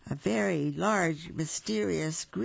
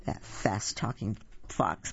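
low bear muttering; muttered; 7 semitones lower than usual. A narrator's reading. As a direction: grumble it under your breath. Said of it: "muttered Bear".

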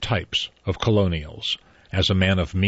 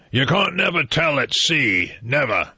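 put-on slurred, higher old sailor's warning; drunk old man; 6 semitones higher than usual. A narrator's reading. Drunk old man warning a stranger on the train, slurred and raised.

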